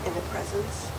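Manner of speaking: softly fed vow line; quiet prompting. Real, not acted. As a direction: murmur the words for repeating. Officiant feeds vow lines.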